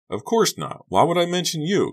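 high-pitched, indignant denial; incredulous denial. A narrator's reading. Kerry answering Josh's question about being mentioned, incredulous. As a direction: pitch jumps right up in disbelief. Dismissing friend's question in dialogue.

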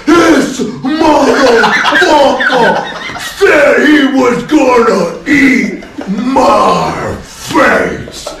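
high, drawn-out shout; loud, slow, scandalized imitation; hysterical tattling. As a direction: holler the complaint in hammed-up outrage. Player complains to bar security.

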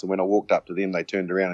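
ordinary talk, nothing marked

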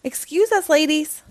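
lively read-aloud storytelling voice; story narration. A narrator's reading. Storyteller reading a Halloween book to children.

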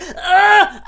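high, loud mock-ecstatic cry; hammed-up cry of overwhelmed delight; giddy squeal. Co-host mimics housewife who can't get over it, giddy squeal. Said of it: "She's so giddy".

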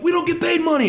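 high, loud, outraged protest; indignant mock yell. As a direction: squeal the protest at the slammed door. His indignant reply retold.

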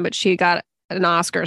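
ordinary talk, nothing marked

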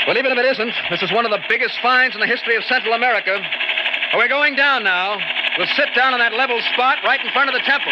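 excited shout over engine. Acted. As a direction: yell it out, thrilled. Shouting over helicopter noise.

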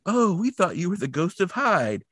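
high-pitched cartoon sidekick voice; Shaggy. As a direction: pitch it well up, like a jumpy cartoon coward. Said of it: "And Shaggy says".

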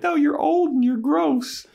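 very high, bratty put-on retort; mock disgust. Buffy retorting to Giles's personal life, mock disgusted. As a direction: squeal it in exaggerated disgust.

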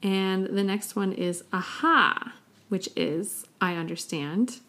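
ordinary talk, nothing marked

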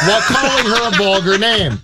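normal talking voice

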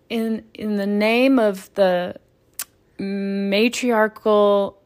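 slow, drawn-out ceremonial pronouncement; solemn blessing voice. Pretend. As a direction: intone it like a priest giving a blessing. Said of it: "I'm building the aesthetic".

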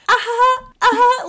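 high mock uh-huh with a frozen face; deadpan fake actor. Podcast host mocking the actors' stiff acting, in a high mock voice. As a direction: imitate stiff bad acting, high and loud. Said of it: "They're literally just like".

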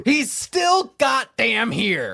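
loud, slow exasperated outburst. Real, not acted. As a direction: drag it out in disbelief.

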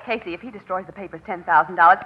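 ordinary delivery

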